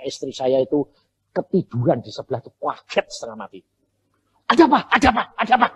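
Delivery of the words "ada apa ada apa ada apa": high, louder alarmed asking; jolted from sleep; wife woken by the scream; startled wife. Retold or imitated.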